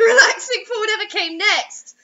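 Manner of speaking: can't keep a straight face reading; laughing. Real, not acted.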